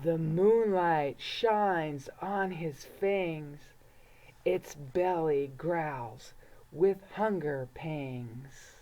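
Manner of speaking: low, slow and hushed, building dread; suspenseful storytelling. A narrator's reading. Reading a spooky book to kids, slow and low. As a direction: read it like a creeping bedtime scare.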